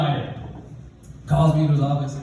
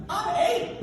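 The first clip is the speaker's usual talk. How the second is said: put-on high-pitched little-kid voice; hammed-up squeaky plea of a small boy; protesting child; 4 dB quieter than usual. Eight-year-old overwhelmed by two adults, protesting.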